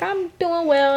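very high, polite customer reply; imitated customer. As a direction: mimic a customer answering pleasantly up high. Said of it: "And they'll be like".

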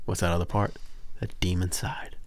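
unvoiced spooky whisper; whispering about a dark side. Real, not acted. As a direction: hush it like a secret. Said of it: "whispered aside".

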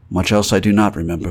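ordinary delivery, nothing marked

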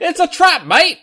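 loud, high Aussie admiral with a laugh; hammed-up Australian, laughing; Australian accent. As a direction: shout it in a playful Australian accent. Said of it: "have Australian accents instead".

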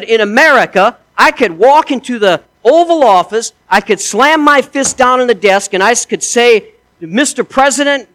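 mock-proud soldier in a retold joke; boastful American soldier. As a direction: crow about freedom to the Russian.

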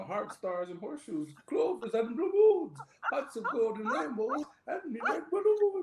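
sing-song Irish jingle, climbing high; bad Irish accent. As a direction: chant it in a hammed-up Irish lilt. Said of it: "I gotta sing the song".

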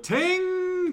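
high, loud vocal ding; mock correct-answer chime; imitated bell.

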